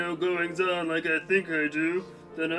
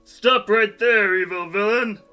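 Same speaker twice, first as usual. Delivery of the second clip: heroic challenge; 3 semitones higher, 5 dB louder than usual. Acted. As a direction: stop the villain like a comic-book hero. Hero confronts villain.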